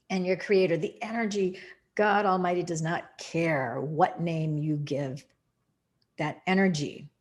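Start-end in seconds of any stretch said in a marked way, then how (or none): none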